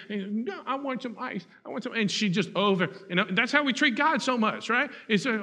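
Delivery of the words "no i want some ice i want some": put-on higher, soft childish insistence; whining child. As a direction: nag like a stubborn little girl.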